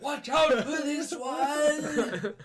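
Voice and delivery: high, loud, drawn-out holler; very slow, laughing mock shout; crowd cheer. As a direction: yell it over a cheering crowd. Crowd cheering the departing contestant.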